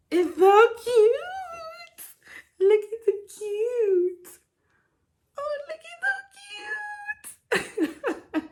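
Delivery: high, slow, teary squeal over something cute; tearful squeal. Real, not acted. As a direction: melt over the tiny outfit, near tears. Squealing over the baby outfit.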